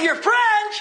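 high, ringing question; incredulous challenge. Retold or imitated. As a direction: throw it back at the devil in disbelief. Preacher challenges devil in retold exchange.

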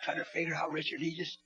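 hushed, low, intimate preacher; tender blessing. Real, not acted. Preacher blessing Richard over last night's worship, hushed and tender. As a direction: bless him softly, almost to yourself.

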